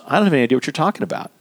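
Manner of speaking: quick, puzzled denial; baffled coworker. Retold or imitated. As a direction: rattle it off, genuinely lost. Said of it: "looks at you and says".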